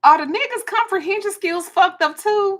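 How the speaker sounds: loud, high-pitched exasperated outburst. Real, not acted. Venting to viewers about a texter not understanding, exasperated. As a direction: ask it in high, loud disbelief.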